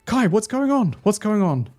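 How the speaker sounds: high, loud, frantic worry; stressed, tearful panic. Acted. Friend holding Kai steady as tears start, stressed and frantic. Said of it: "looking absolutely stressed right now".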